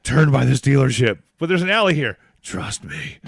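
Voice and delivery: loud, sly app voice; shady GPS voice. Pretend. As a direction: sell a bad shortcut. Voicing Waze app.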